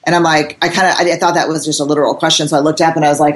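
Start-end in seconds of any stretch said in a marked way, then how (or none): none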